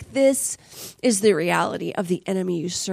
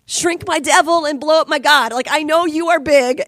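louder, higher urgent plea; pleading prayer; 5 semitones higher, 7 dB louder than usual. Retold or imitated. Woman begging the Lord for help, urgent pleading. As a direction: cry out to God with force. Acting out a desperate prayer to God.

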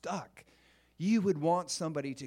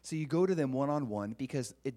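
ordinary talk, nothing marked

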